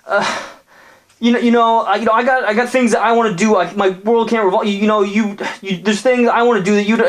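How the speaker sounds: high, fumbling defensive jock; flustered boyfriend voice, pitched high; defensive bro. Acted. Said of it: "the bro was like".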